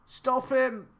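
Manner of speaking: high urgent yell at a player; shouting at screen. Real, not acted. Gamer shouting at his player to stop the attacker.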